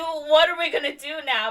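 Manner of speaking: high, loud retold fretting; mock panic. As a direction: plead in comic alarm. Acted Lucy freaking out to godfather.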